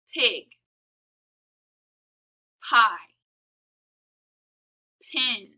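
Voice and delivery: p popped at the start of pig; clear model words. Shown as a demonstration. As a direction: punch the p in each word. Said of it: "listen to these words and repeat".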